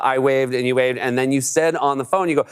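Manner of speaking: ordinary talk, nothing marked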